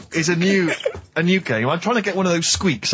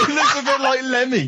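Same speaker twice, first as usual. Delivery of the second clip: laughing; 9 semitones higher than usual. Real, not acted. Laughing at the photo.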